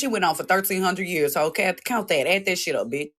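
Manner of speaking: ordinary delivery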